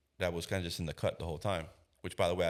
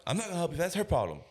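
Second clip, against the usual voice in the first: high-pitched defensive retort; mock refusal; 10 semitones higher, 3 dB louder, faster than usual. Father refusing the officer's request, mock refusal. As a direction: refuse point-blank in a raised voice.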